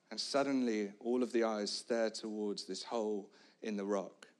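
usual speaking voice

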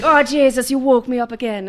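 hammed-up Irish husband, laughing through it; laughing Irish accent, husband woken by crying. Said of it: "That's why I'm doing that voice".

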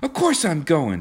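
high, insistent interruption; impatient. A narrator's reading. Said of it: "she interrupted impatiently".

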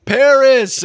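hammed-up announcement of Paris; mock Damon exclamation. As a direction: exclaim it like a proud tour guide. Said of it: "I do love that Damon goes".

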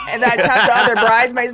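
usual speaking voice

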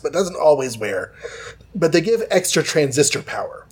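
usual speaking voice

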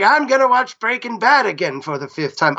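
a hint of an old man; lightly voiced old retiree. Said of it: "it really works with my old man voice".

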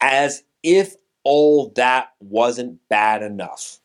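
every word stretched slow and heavy; slow, drawn-out read. Shown as a demonstration. Host tries a slow dramatic read of the line, very slow. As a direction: take it slowly, leaning on if.

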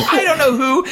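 high mock-frantic cry; acted desperate protest. Victim pleading in retold quarrel.

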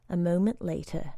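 ordinary delivery

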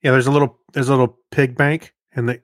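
ordinary talk, nothing marked

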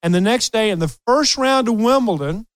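ordinary talk, nothing marked

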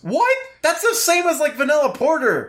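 high, shocked disbelief; can't believe the price, voice leaping up; surprised. Real, not acted.

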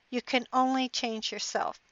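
ordinary talk, nothing marked

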